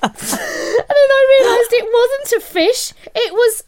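high, strained with real laughter; cracking up, pitch way up; laughing through it. Real, not acted. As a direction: struggle to read the punchline through giggles. Cracking up while reading punchline.

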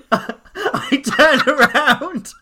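high, loud, slow, laughing through the words; can barely get it out for laughing; giggling at her own story. Real, not acted. Laughing while retelling the moment.

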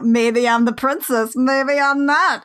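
slow, put-on teasing lilt; corny joke. Host mimicking Kimber's dumb joke, corny teasing. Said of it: "makes some kind of dumb joke".

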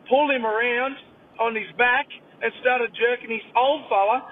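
usual speaking voice

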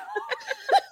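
very high-pitched giggle; real laugh. Real, not acted. Friend laughing at the figurine fumble, high giggle.

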